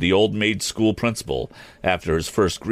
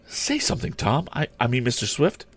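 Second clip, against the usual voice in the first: put-on high, pleading young girl; urgent girl; 8 semitones higher than usual. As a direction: urge him anxiously. Mary urges Tom.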